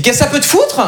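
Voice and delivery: high-pitched, indignant mock retort; rude rebuff. Parisian woman rebuffs a friendly hello, indignantly. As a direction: shut the greeter down indignantly.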